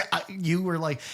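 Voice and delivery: normal talking voice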